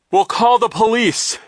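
high, loud exclamation; flustered high shout; urgent gasp. A narrator's reading. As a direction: blurt it out in alarm. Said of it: "Mister Poe gasped".

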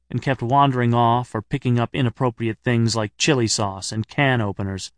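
ordinary delivery

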